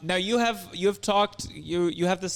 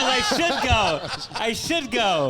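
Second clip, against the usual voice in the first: high slow teasing imitation; mocking repeat; 6 semitones higher, much slower than usual. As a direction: tease by drawing it out. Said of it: "It always goes".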